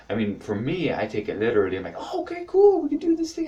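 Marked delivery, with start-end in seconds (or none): 2.0-3.5 high, eager squeal; mock excited. Taking her yes literally, high mock excitement. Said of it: "I get excited about it".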